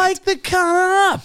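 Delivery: 很高、很慢地拖长嚷出来; 夸张地模仿着大喊; mock drawn-out yell. Mocking the retold demand.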